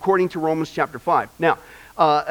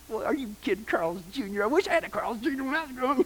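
grandma scoffing; 5 semitones higher, 8 dB quieter than usual. Pretend. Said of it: "You s".

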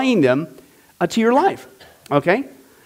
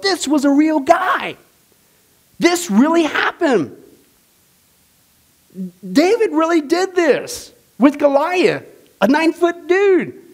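awed emphasis; 7 semitones higher, slower than usual. Real, not acted. Preaching in awe.

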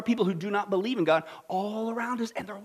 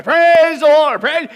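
loud, higher mock shout of praise; 5 semitones higher, 11 dB louder, much slower than usual. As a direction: belt it out like the old lady. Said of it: "she would".

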